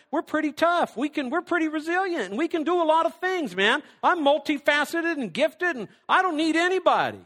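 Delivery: high, loud mock bragging; self-sufficient boasting. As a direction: boast like you need nobody.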